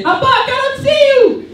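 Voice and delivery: very high, loud teasing cry; friend calling out. Acted. Friend calling to him after lights go off, high mocking shout. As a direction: call out shrilly, mimicking a friend in the dark. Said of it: "a friend will say".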